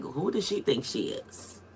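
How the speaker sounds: like catty gossip, under the breath; gossiping sneer. Retold or imitated. Imagined gossip from people like you.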